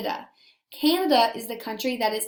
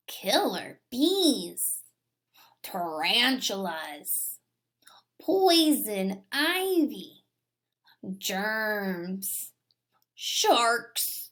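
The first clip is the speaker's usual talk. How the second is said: slow hushed whisper of each danger; slow eerie whispered list; spooky whisper; 2 semitones lower, much slower than usual. Acted. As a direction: whisper them like creeping threats. Listing scary things whispered.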